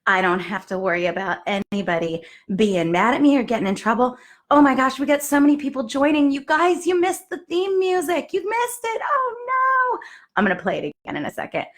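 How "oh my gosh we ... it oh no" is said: excited. Real, not acted. Viewers missed the theme music.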